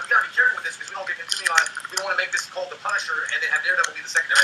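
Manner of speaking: rattled off quickly; rushing through the quote; fast quote. Retold or imitated. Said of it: "So they were like".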